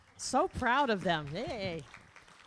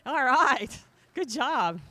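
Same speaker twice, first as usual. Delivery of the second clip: excited praise; 6 semitones higher, 8 dB louder, slower than usual. Real, not acted. Praising kid's trophy win.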